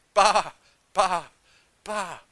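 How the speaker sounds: lamb-like bleating. Retold or imitated. Preacher showing how he prayed, bleating loudly like a lamb. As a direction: repeat "ba" like a lamb, punching the first. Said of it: "I sounded like a lamb".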